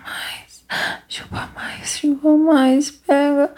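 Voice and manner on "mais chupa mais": soft unvoiced whisper. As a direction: breathe the words without voice. Whispered then voiced repeat.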